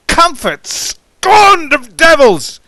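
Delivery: bitter ringing scorn; scornful outburst. Acted. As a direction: Comfort flung out high and loud, then slow. Scornful outburst after the question.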